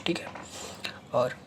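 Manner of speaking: ordinary talk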